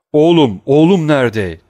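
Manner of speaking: loud high calls of the name; shouting. A narrator's reading. Father yelling his son's name through the house, loud. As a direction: yell Edward down the hall. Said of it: "diye sordu Wilford".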